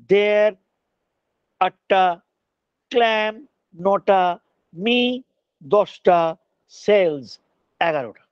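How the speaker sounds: syllable by syllable with long pauses; counting syllables. Shown as a demonstration. Teacher counting a poetic line for students, measured syllable-by-syllable demonstration. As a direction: chop the verse into separate syllables, numbering as you go. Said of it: "তাহলে এই লাইনটায় দশটা syllable হবে না".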